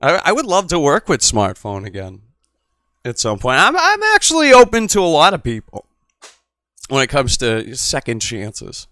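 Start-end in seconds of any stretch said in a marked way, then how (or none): 3.6-5.9 raised, pitched-up voice; loud declaration. Real, not acted. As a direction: proclaim it loudly.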